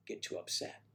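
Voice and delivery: a whispered aside; whispered aside about Angela. Real, not acted. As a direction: whisper it so she can't hear.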